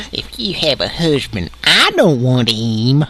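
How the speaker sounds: drawled Southern twang; Southern accent. Retold or imitated. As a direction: stretch it out Southern style. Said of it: "That's one of my favorite Southern things".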